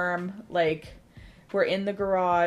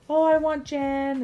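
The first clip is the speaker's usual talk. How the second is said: high, loud, drawn-out mock wail; Addie throwing a fit; 9 semitones higher, 6 dB louder than usual. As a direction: imitate a tantruming child, stretching the words. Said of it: "Addie's throwing this fit".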